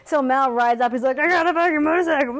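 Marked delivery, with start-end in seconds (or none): 1.2-2.4 high, fast, whooping show-off; mock excited boast. Acts Mal riding up.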